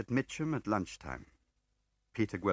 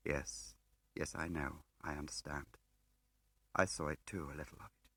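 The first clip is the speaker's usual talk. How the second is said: low, softer, whispered at the end; soft reply; 4 semitones lower, 6 dB quieter than usual. A narrator's reading. Smiley quietly admitting to the woman, soft. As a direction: answer gently.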